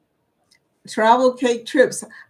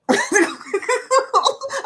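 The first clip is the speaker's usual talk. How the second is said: high ringing laugh; high delighted laugh. Real, not acted. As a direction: burst out laughing. Laughing at the remark.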